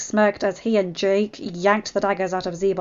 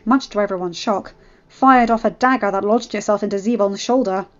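usual speaking voice

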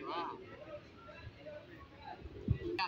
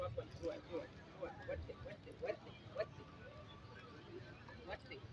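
whispering; 13 dB quieter than usual. Real, not acted. Urging quietly, whispered.

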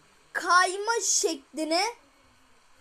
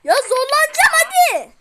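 straining yell; 8 semitones higher, 8 dB louder than usual. Real, not acted. Gamer straining at hard jump.

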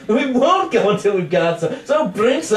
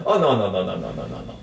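muttered denial; 6 dB quieter, faster than usual. Acted.

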